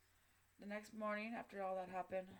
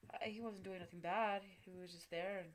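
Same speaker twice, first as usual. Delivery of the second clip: ordinary talk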